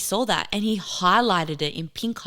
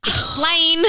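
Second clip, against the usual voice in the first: stern dad; 10 semitones higher, 7 dB louder than usual. Retold or imitated. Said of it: "highlighted it and was like".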